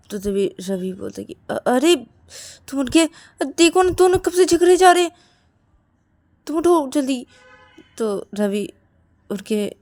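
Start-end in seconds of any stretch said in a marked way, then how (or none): 1.5-7.3 high, loud urging; urgent appeal. Acted. Ravi rousing Rahul to see the fight, urgent. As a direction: urge him to get up.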